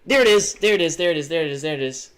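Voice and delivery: a burst of delight that fades as it repeats; excited. Real, not acted. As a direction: shout it out on finding it, then calm down. Spotting the town on map.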